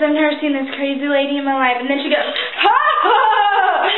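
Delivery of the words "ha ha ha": big, slow mock laugh; imitated loud laugh. Imitating how Oprah laughed at her, loud mock laugh. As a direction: put on a loud, drawn-out "ha, ha, ha". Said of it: "she laughs really loud".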